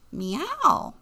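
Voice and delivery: high, loud meow; high-pitched cat call. Acted. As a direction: mew like a cat. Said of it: "Jack met a cat".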